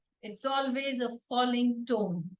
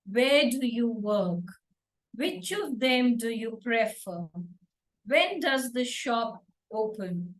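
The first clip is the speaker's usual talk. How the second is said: slow WH question with the pitch falling at the end; slower than usual. Shown as a demonstration. Teacher models WH questions, falling tone. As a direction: say it slowly, letting the last word drop. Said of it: "always have a falling tone".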